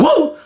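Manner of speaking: high, loud "whoa" yell; sudden shouted whoop. Shown as a demonstration. Coach showing his yell on the bike, sudden whoop. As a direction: let out a whoop for no reason. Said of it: "Sometimes just fucking shout".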